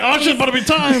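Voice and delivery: high-pitched, hammed-up retold alarm; mock dramatic. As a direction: play up the mock panic. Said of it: "He's being dramatic".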